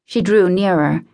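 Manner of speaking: ordinary delivery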